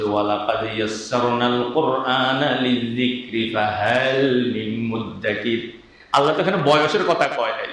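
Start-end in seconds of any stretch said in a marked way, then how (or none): 0.0-5.8 slow, drawn-out chanted recitation of the verse, fading softer at the end; slow Quran recitation. Retold or imitated. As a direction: recite the verse slowly like tilawat. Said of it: "আল্লাহ যে বলেছে".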